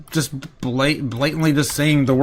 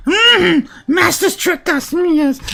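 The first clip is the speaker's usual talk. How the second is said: high, slow, hissing cave creature; Golem voice; 3 dB louder, slower than usual. Pretend. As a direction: creep out the words like Gollum. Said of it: "in the Golem voice".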